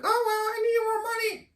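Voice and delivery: high falsetto mimicking a woman; squeaky whiny imitation of her; mocking woman's voice. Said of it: "you're like".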